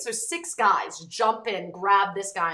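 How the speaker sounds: ordinary talk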